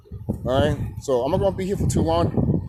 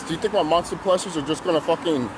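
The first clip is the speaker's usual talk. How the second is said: ordinary delivery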